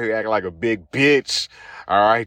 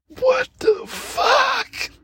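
stunned shout; much slower than usual. Acted. Host shocked by the show's dialogue.

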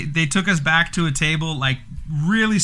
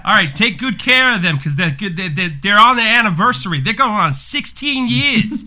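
higher Jersey waiter voice, slowing at the end; hammed-up Jersey accent; 5 semitones higher, 3 dB louder than usual. Host tells Vinny to seat the anniversary couple, hammed-up Jersey accent. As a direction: play the Jersey host bragging about the couple. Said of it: "it sounded like that".

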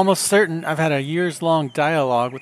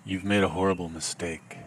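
low, soft, menacing threat; a quiet killer's warning, much lower; Liam Neeson-esque menace; 9 semitones lower, 9 dB quieter than usual. Acted. Threatening the scam caller in a low, soft Liam Neeson menace. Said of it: "a sort of Liam Neeson-esque tone".

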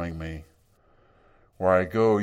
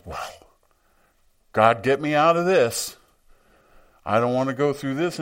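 louder and higher, mock-pleading sarcasm; mock plea, dripping sarcasm, loud and high; sarcastic Jesus; 5 semitones higher, 7 dB louder than usual. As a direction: whine it like a sarcastic complaint you don't mean. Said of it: "I see him being sarcastic".

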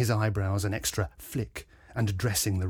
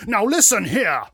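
loud, higher, blustering outburst; harrumphing Major; 11 semitones higher, 9 dB louder than usual. A narrator's reading. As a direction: harrumph indignantly in someone's defence. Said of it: "harumphed the Major".

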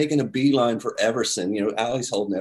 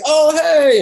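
loud greeting; 10 dB louder than usual. Retold or imitated. Paul greets David Blaine loudly.

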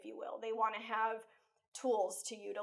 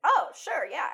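put-on kids agreeing; 7 semitones higher, 9 dB louder than usual. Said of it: "they're like".

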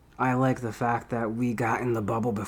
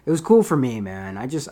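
normal talking voice

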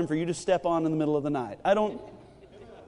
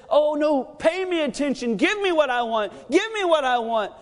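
put-on whining toddler flesh; 8 semitones higher than usual.

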